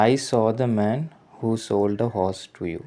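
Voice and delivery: very slow, word by word, for students; slow dictation. Shown as a demonstration. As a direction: spell each word out slowly like dictation. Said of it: "teacher reads example sentence". (പതുക്കെ വാക്ക് വാക്കായി വായിക്കുന്നു)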